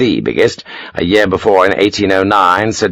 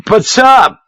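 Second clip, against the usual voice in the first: high, louder pleading; a frightened young officer begging; desperate protest; 5 dB louder than usual. A narrator's reading. Lieutenant pleading with Sharp, high frightened protest.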